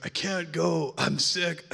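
put-on sick caller voice; faked sick voice. Pastor pranking Rob by faking sickness, mock sick. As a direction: play it up as if too ill to come. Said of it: "they're just pranking me".

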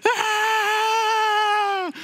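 mock panicked wail of a small daughter; terrified little girl. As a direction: squeal it like a scared kid on a ride. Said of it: "all I hear is my little girl going".

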